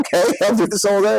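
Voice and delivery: high, tickled, mock-smug reply; playful smug. Actor jokes about skipping the airport queue, high and gleeful. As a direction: relish the perk out loud. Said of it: "And I was like".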